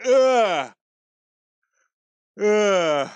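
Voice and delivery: groan. Real, not acted. Frustrated at laggy stream.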